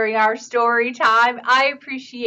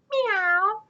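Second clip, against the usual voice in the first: kitten meow; 10 semitones higher than usual. Acted. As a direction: meow meekly. Roar shrinks to a meow.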